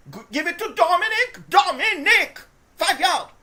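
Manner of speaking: cheering shout for Dominic; excited shouting. Real, not acted. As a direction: yell at the screen for the run. Yelling at the running back.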